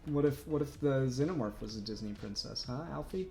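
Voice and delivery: normal talking voice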